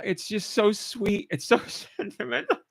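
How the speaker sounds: high, tearful voice breaking into laughs; choked up. Real, not acted. Fan overcome by game's sentimental dialogue, choked-up laughing. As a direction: struggle to talk through happy tears. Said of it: "I'm having such a hard time talking".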